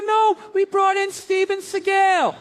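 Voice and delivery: high, slow mocking voice; mock announcement. Superior telling cops about trainer, high slow mock voice. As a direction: drag it out high and slow, poking fun. Cops told who will teach.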